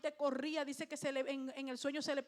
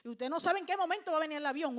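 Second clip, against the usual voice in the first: normal talking voice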